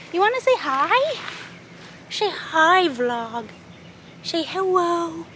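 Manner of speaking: high sing-song pet voice. Real, not acted. Talking sweetly to the cat.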